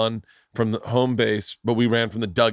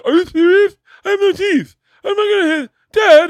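much higher, louder incredulous protest; outraged kid; 9 dB louder than usual. Retold or imitated. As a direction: plead like a hurt kid who can't believe it. Kid protesting to his dad.

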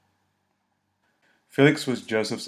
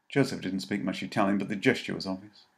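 usual speaking voice

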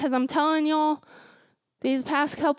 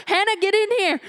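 grandma calling; 5 semitones higher, faster than usual. Retold or imitated. Grandma calls Hannah back from the bathroom, firm. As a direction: summon Hannah firmly. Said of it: "And she said".

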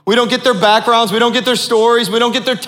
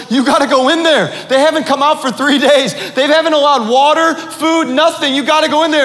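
higher, urgent retold plea; urgent woman pleading; 7 semitones higher than usual. House owner urging the evangelist to check on praying men, urgent. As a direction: voice an alarmed homeowner, pitched up.

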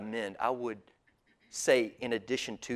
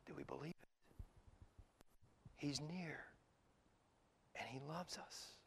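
very soft, almost whispered question and assurance; hushed whisper; 21 dB quieter than usual. Real, not acted. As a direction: lean in and barely whisper. Hushed sermon reflection.

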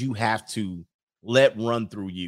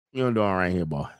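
too-fast Mississippi accent; 5 semitones lower, much faster than usual. Shown as a demonstration. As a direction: rush through it in a Mississippi accent. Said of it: "that was a little too fast".

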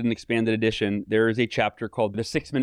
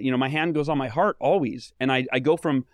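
ordinary talk, nothing marked

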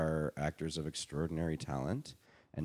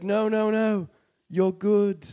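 high, loud, put-on reassurance; playful imitation, pitched way up; 10 dB louder, much slower than usual. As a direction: mimic a star cheerily declining. Retold [name] waving him on.

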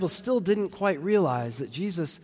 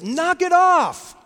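loud, high, drawn-out exasperated shout; full shouted order to stop; yell; 13 semitones higher, 8 dB louder, much slower than usual. Acted. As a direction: yell it at the crashing waves. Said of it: "yelled at the waves".

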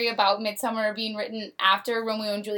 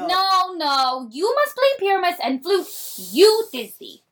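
insistent director; 8 semitones higher, 6 dB louder, slower than usual. Acted. Reading play scene.

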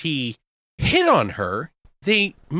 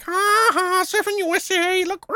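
much higher, hammed-up movie character; goofy seducer; 14 semitones higher than usual. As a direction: imitate a silly man clowning while flirting. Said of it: "like, trying to talk sexy".

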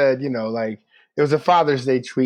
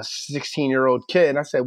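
plain reading voice

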